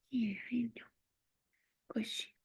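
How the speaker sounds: soft whispered lullaby to a dog; whispering the dog back to sleep; soothing whisper. Real, not acted. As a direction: hush the puppy gently to sleep.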